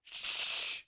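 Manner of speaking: long, soft shush. Acted. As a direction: hush someone with a drawn-out hiss. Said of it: "they warn him to be cri-, quiet".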